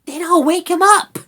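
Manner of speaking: high, loud spat-out retort; snappy, defiant little rat; spitting retort. A narrator's reading. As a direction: spit it back in a small rat voice. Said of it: "spat the rat".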